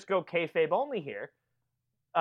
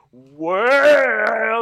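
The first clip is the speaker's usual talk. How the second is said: loud battle yell on a ridge; war cry; 4 semitones higher, 8 dB louder than usual. Pretend. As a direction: bellow it at the enemies.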